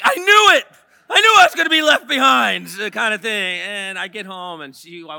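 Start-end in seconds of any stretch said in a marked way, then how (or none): 0.0-2.7 high, loud mock panic; panicked cry. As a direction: yell it like you've been left behind. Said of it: "And I'm like".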